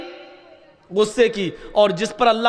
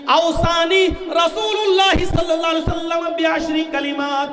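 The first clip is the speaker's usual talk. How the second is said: hadith recitation; 7 semitones higher, slower than usual. Real, not acted.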